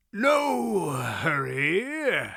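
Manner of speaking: very slow, wheezy cackling voice; wheezy cackle. A narrator's reading. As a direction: cackle it out like a doddering old man. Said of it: "his wheezy cackle".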